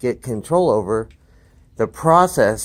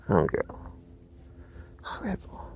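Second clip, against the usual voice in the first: breathy whispered praise; whispering to dog; 14 dB quieter than usual. Real, not acted. Trainer whispering softly to his dog while petting. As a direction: whisper to her to keep her calm. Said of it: "whispering to the dog".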